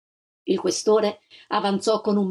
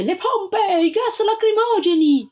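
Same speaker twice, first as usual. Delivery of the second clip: much higher, louder officer's yell; a distant voice yelling orders high and loud; shouted order; 11 semitones higher, 4 dB louder, slower than usual. A narrator's reading. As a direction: bark the order from the crowd. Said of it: "Si udì una voce".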